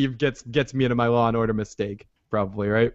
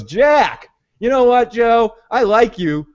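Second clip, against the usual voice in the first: mock presidential griping, pitched up and easing down at the end; exasperated Heller; 4 semitones higher than usual. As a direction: act out a fed-up president in a raised voice. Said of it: "Heller's like".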